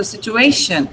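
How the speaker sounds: situation said situ-A-tion; weight on the A in situation; stress on A. Shown as a demonstration. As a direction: situation with AY strongest, shun light. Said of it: "A is stressed".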